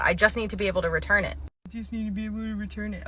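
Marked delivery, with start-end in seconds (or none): 1.7-3.0 quieter, fast, in a put-on customer voice; softer mock voice. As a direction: mutter it back mockingly. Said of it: "repeats the line differently".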